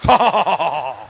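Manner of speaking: long, low, mechanical "ho-ho-ho" laugh; fake club laughter. Speaker imitating morning laughter-club members in the garden, forced laughing. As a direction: force out a steady mock laugh, falling in pitch. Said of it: "Siddharth गार्डनमध्ये सकाळी हसतील".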